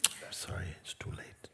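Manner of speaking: a master quietly whispering the bad news to a devotee; hushed grave news. Master breaks grave news softly.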